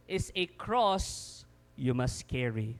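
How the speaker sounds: ordinary talk, nothing marked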